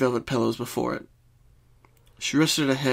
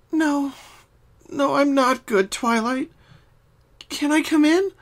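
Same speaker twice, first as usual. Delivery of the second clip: higher, subdued pony voice; Pinkie voice; 14 semitones higher, 3 dB louder than usual. Acted. Pinkie asks Twilight to come in, higher and subdued. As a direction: voice Pinkie, downcast but polite. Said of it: "minding her manners for once".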